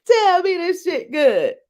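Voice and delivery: high, loud, whiny mock plea; mock bedroom plea. Woman hamming up bedroom talk to her partner, high whiny plea. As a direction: beg it up high and loud, half laughing. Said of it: "I'd be like".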